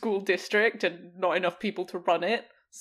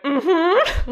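a high, loud, clipped mm-hmm of forced agreement; curt mm-hmm; 8 semitones higher, 9 dB louder than usual. Retold or imitated. Teacher retells answering a chatty adult, a high curt mm-hmm. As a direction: cut her off with a bright, loaded mm-hmm. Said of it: "like that, just like that".

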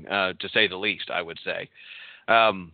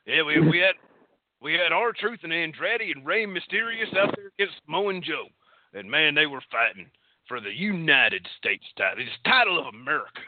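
put-on awful Texas accent; 5 semitones higher than usual. Host mock-recapping the match for co-hosts, in a bad Texas accent. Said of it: "your Texas accent is awful".

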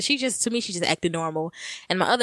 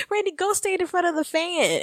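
high mock instruction; 10 semitones higher than usual. Quotes friends while still laughing.

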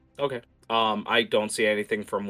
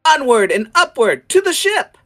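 put-on very high, loud child's battle cry; little girl rallying; 14 dB louder than usual. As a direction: cry out like a child commanding a steed. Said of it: "just goes".

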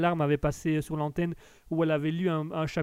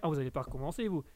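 ordinary talk, nothing marked

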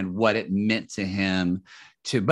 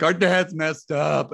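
slow, higher mock-tearful voice; imitating crying brother; 5 semitones higher, slower than usual. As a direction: lightly play a brother on the verge of tears. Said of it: "he calls me and he's crying".

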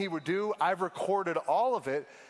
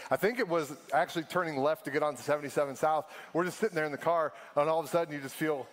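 usual speaking voice

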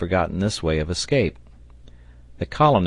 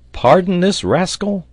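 high, loud disbelieving question; incredulous outburst; 11 semitones higher, 7 dB louder than usual. A narrator's reading. Sailor told to spare the orang, incredulous. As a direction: throw it back in protest. Sailor objects to sparing ape.

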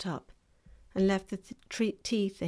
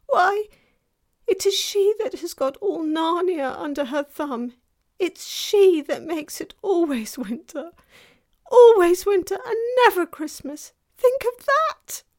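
very high, loud wail; anguished faun; 11 semitones higher, 10 dB louder than usual. A narrator's reading. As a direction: bewail it as a despairing faun. Faun bewails the witch's winter.

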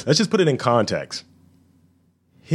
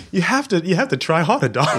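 higher, hammed-up movie-character line; higher mock film hero line, half-joking; mock Eames quote; 8 semitones higher than usual. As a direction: toss off a cocky movie line in a put-on voice. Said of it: "He's like".

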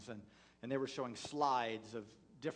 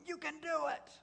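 retold cheering shout. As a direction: call down the mountain to spur runners on. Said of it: "they're screaming".

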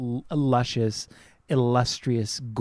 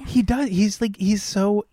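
disgusted squeal; 8 semitones higher, slower than usual. Real, not acted.

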